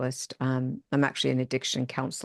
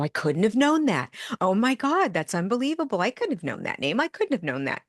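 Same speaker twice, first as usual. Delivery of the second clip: excited disbelief; 6 semitones higher than usual. Retold or imitated. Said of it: "And I'll come out and go".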